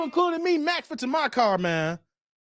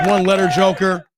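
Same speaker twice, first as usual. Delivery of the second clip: louder, hammed-up scolding of Joker; playful loud call-out over a cheap tip; mock scolding shout; 7 dB louder than usual. Real, not acted. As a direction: bark it at him in jest. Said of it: "mock scolding over one-letter super".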